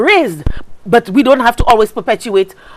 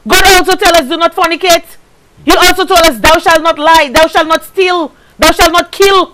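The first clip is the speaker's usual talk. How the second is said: preaching cadence; 5 semitones higher than usual. Real, not acted. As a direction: list the commandments like a preacher. Preaching the commandments.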